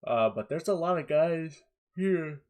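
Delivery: talking through a yawn; words stretched by a big yawn. Real, not acted. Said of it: "Apparently, I'm really tired".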